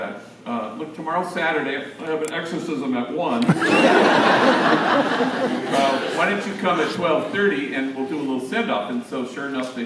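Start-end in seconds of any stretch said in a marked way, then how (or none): 3.5-5.7 big breathy burst of laughter at his own joke; hearty laugh. Real, not acted. Priest laughing at his own exorcism joke, loud and breathy. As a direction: let the laugh spill out loud.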